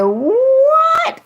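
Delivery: high squeaked shocked what. Retold or imitated. Remembering hearing the advice, a stunned what. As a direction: squeal it in disbelief.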